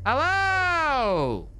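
high, drawn-out hello; talking loud. Shown as a demonstration. Player asked to talk loud, long high call. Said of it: "try talking loud".

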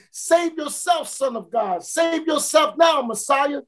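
high jeering yells that drop off at each end; mocking scream. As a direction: taunt the condemned man from the roadside. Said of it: "Then I screamed".

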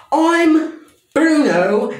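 high, slow, drawn-out little mouse voice; mock squeaky mouse. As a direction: stretch it out like a tiny mouse. Imitating Bruno in the original.

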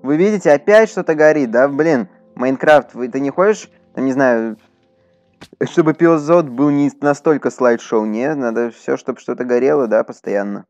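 ordinary delivery

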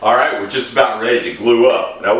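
ordinary talk

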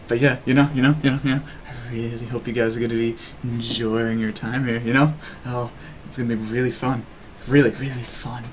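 low, hushed seductive chat; sultry mistress. Pretend. Streamer offering chat a good time and coffee, sultry. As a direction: purr to the viewers in a deep, soft voice. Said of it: "now you're sultry misters too".

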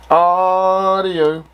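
ordinary talk

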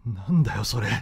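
ordinary talk

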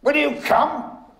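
high, loud, exuberant call; high-pitched theatrical excitement; exuberant cry. Acted. Acted character, exuberant high cry.

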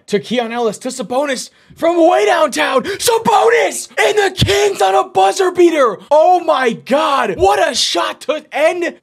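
very high, loud, drawn-out yell; full-throated announcer scream; screaming hype. Real, not acted. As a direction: scream like a buzzer beater just dropped. Buzzer beater wins game.

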